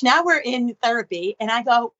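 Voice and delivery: ordinary talk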